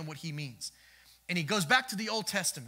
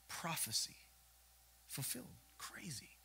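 much softer, awed murmur; hushed awe; 14 dB quieter than usual. Real, not acted. Pastor marveling quietly at prophecy fulfilled. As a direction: let it drop to a near hush.

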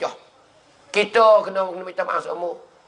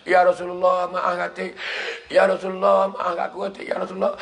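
exhausted dying plea. Retold or imitated. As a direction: act out dying breaths, each plea trailing away. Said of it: "Tu ada letih dah tu". (lakonan rayuan nazak yang tercungap-cungap)

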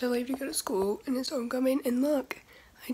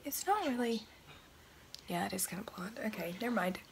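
whispering; 8 dB quieter than usual. Real, not acted. Whispering the hair update in gym.

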